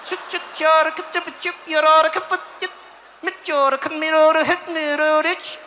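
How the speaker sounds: put-on high, loud Swedish-accented gibberish; curt mock Swedish. Swede who can't mess around in winter, curt mock Swedish. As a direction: rattle out clipped mock Swedish against the cold. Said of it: "you can't mess around out there".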